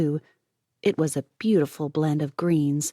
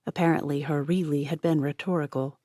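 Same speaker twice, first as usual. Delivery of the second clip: usual speaking voice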